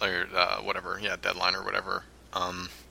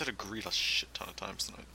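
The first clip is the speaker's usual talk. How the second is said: whisper; 3 semitones lower, 13 dB quieter than usual. Real, not acted. Whispered aside about himself.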